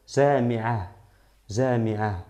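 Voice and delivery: slow JAMI'AH, ending on air from the throat; aire de la garganta. Shown as a demonstration. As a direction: finish "jami'ah" with a small exhaled h, no t. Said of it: "sacar aire de tu garganta". ("jami'ah" lento, soltando aire al final)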